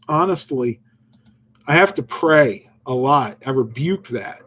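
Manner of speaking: normal talking voice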